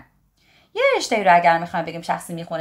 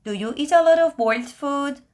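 high, bright-toned question voice; curious asker; 7 semitones higher, faster than usual. Acted. Teacher voicing a friend who asks about food, high and curious. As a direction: voice a second speaker asking.

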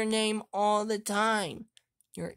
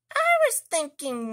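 usual speaking voice

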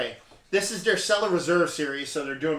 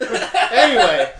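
real laugh breaking through; slow, loud, laughing through the word; 7 semitones higher, 11 dB louder, much slower than usual. Real, not acted. Laughing after friend's playful retort.

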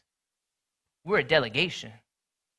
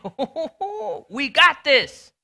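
higher and louder, drawn out slowly with a laugh; cocky excitement; 8 semitones higher, 7 dB louder, much slower than usual. Retold or imitated. Team after winning the first game, cocky excited boast. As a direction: crow about winning game one. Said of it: "You're like".

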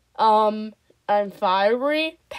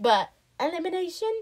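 usual speaking voice

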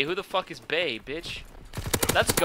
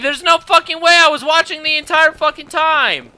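loud furious outburst, higher; angry yelling; 9 semitones higher, 8 dB louder than usual. Real, not acted. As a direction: yell in disbelief at the game. Rage at missed kill in game.

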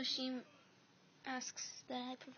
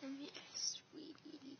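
whisper; 5 dB quieter, slower than usual. Acted. Villain luring softly.

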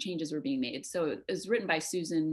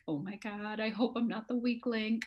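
retold worry; 4 semitones higher than usual. Actor recalls fearing the other actors, mildly worried. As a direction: voice a mild private dread. Voices her past worry among trained actors.

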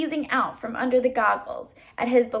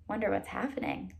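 usual speaking voice

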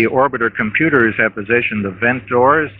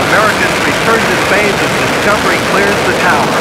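higher and louder proud call; triumphant announcement; 7 semitones higher, 4 dB louder, faster than usual. Real, not acted. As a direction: announce it like a historic moment. Announcing shuttle clearing tower.

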